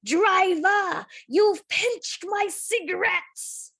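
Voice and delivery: high, loud, slow slurred accusation; a drunk soldier yelling at the driver; drunken soldier crying out. A narrator's reading. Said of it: "the drunken soldier roll up and cry".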